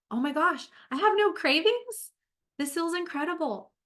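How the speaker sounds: imagined self overjoyed at having no cravings; ecstatic realization. Pretend. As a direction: shout it with delighted disbelief. Repeated line, second take excited.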